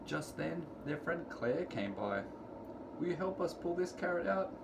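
usual speaking voice

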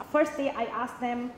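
ordinary delivery

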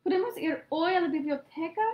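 begging. A narrator's reading. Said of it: "le rogó Santiago".

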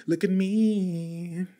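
slow, high, stretched out; drawn out. Acted. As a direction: drag it out playfully. Showing off hands, stretched.

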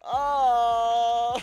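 loud fed-up cry; exasperated shout. Real, not acted. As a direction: groan out loud in defeat. Outburst after death.

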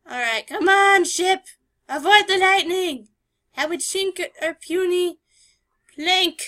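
loud high urging; urgent cheering. Real, not acted. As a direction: urge the ship on. Steering through storm.

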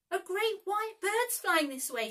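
high, loud excited shout. A narrator's reading. Nibs shouts to the Lost Boys, excited and loud. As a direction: call it out to the others. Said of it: "shouted Nibs".

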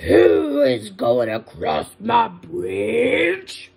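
loud, drawn-out troll bellow; troll's shout. Acted. Troll challenges the little goat on the bridge, slow shout. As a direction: roar it out slowly, stretching each word. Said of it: "jumped up from under the bridge and shouted".

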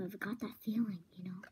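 hushed, quick conspiratorial whisper; secret whisper. Real, not acted. Friends plot in secret about the visitor, whispering. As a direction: whisper fast so she won't hear.

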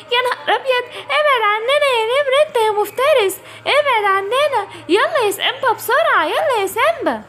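high, desperate cry to back off; frightened plea. Acted. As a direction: shout at the beast in fear. Kids face the tiger. (صرخة خوف في وش النمر)

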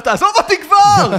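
shouting at the vendor to leave him alone, loud; retold shout. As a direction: yell it while running away. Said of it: "ואני צועק עליו".

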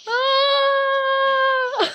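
long, high, loud scream; sustained shriek at full volume. Real, not acted. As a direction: scream out, then break into laughter. Startled by friend hiding.

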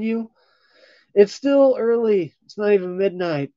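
high, loud teasing Deadpool voice; playful high mock-flirty character voice. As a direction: tease them as a cheeky Deadpool.

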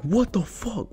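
high, soft squeal of mock disbelief; mock his freak-out in a small high voice; imitated freak-out. Rival kid called for a foul, mock freaking out. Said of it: "freaks out".

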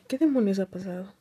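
ordinary talk

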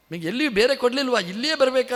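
fast, high and loud retort; imitating a donor snapping at people asking for donations; mock indignant snub. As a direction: brush off the beggar at the door. Donor snubs donation seekers.

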